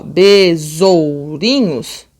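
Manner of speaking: BESOURINHOS very slow, with OU held together; syllables sounded out. Shown as a demonstration. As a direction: stretch BESOURINHOS, gliding through the ou. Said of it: "eu acabo falando junto".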